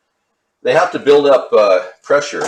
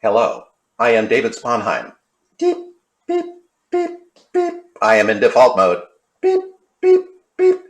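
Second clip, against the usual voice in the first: robot act. Pretend. To viewers, working on his robot act, robot voice. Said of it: "my robot act".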